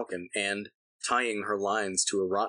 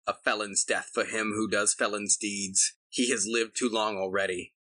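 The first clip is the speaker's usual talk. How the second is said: ordinary delivery, nothing marked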